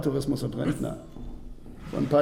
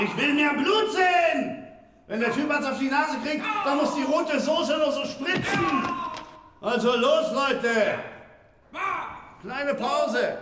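high, slow, shouted demand for more blood; director's shout; slower than usual. Acted. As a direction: bellow across the set at the crew. Film director yelling at the crew.

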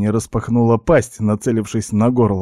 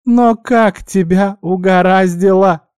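high, loud, slow-stretched disbelief; exclaiming guinea pig; 10 semitones higher, 5 dB louder, slower than usual. A narrator's reading. Guinea pig hearing of body swap, high incredulous exclamation. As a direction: squeal it out, stretching the words. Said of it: "воскликнула Винни".

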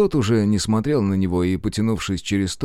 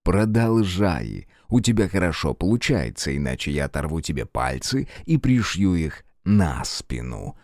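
mock friendly chirp; 4 semitones lower than usual. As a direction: purr the threat as if kindly. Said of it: "доброжелательно прощебетал Фергус".

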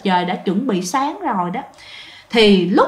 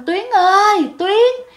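high, ringing call of a name across the yard; 10 semitones higher, slower than usual. Retold or imitated. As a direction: call her name out from a distance. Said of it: "mới kêu lên".

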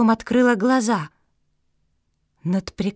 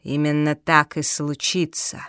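sinister villain; 5 semitones lower than usual. Acted.

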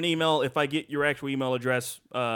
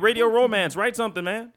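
louder, higher call-out; 6 semitones higher, 4 dB louder than usual. Real, not acted. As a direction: call out to the listener. Calling out to listener.

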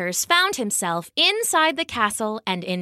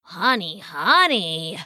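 buzzy bee; 3 semitones lower, slower than usual. Acted. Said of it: "buzzed a bustling bumblebee".

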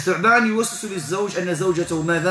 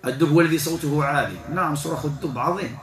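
ordinary talk, nothing marked

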